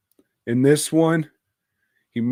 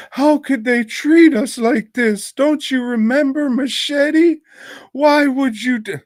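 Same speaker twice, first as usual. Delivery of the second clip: very high, loud, hammed-up protest; mock outraged complainer; 6 dB louder than usual. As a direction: wail it way up high, mocking the offended. Mocks outraged complaints about the movie.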